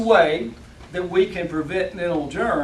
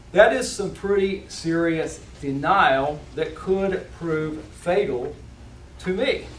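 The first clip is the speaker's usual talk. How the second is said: ordinary talk, nothing marked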